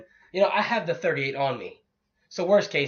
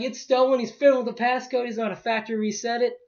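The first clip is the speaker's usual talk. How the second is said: alarmed realization; 9 semitones higher, 7 dB louder than usual. Retold or imitated. Alarmed realization, retelling his own thoughts.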